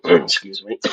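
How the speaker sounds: hacking coughs, then excuse me; coughing fit. Real, not acted. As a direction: cough hard and clear the throat. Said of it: "I'm sorry I'm hacking".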